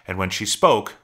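plain reading voice

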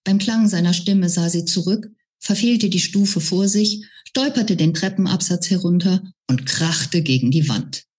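plain reading voice

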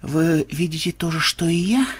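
soft, halting hoarse question; hushed, husky disbelief. Acted. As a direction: ask it hoarsely with stunned pauses. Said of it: "хрипло спросил Ксенобайт".

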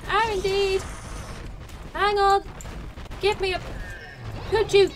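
high, loud, halting pleas; flustered alarm. Pretend. Player under attack pleading for a moment, flustered alarm. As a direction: fend off an attacker in playful panic.

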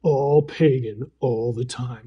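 low, slow, sinister announcer; sinister deep voice. Retold or imitated. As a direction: growl it out like an ominous radio ad. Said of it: "real sinister, deep voice".

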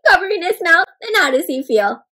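ordinary delivery

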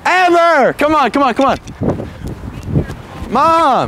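high, urgent call. Real, not acted. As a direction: call across the street for help. Calling out to arriving mother.

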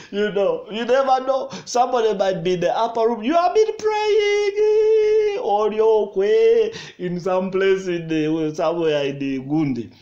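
high, loud, excited pulpit voice; fervent preaching. Real, not acted. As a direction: climb to a high, stretched cry. Preaching at full force.

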